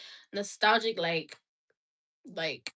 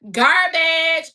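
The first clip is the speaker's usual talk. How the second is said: loud outburst; 2 semitones higher, 9 dB louder than usual. Pretend. Singing along loudly.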